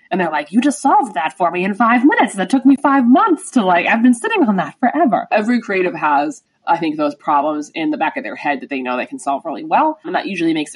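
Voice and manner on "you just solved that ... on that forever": amazed grateful client. Retold or imitated.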